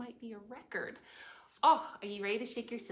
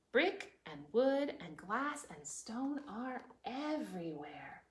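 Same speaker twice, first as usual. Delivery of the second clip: usual speaking voice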